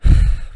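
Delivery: a big, loud sigh of despair; heavy sigh of defeat; loud exasperated sigh. Real, not acted. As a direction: blow out all the frustration.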